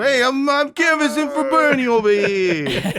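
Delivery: high, loud hammed-up New York street guy; New Yorker yelling while canvassing for Bernie; New York accent. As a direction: bark it like a Queens canvasser. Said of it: "someone with that accent".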